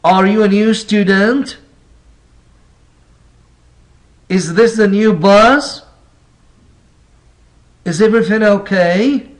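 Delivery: questions lifting upward on "student", "bus" and "okay"; rising intonation. Shown as a demonstration. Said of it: "Rising intonation is common in yes/no questions".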